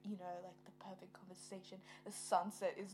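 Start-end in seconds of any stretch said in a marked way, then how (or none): 0.4-1.8 hushed whisper. Real, not acted. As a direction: breathe it softly. Dreamy soft aside.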